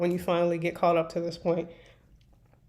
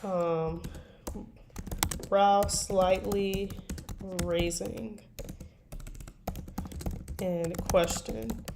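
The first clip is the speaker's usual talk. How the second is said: ordinary delivery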